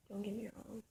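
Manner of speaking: ordinary talk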